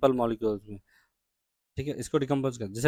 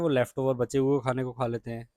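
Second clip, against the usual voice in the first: ordinary delivery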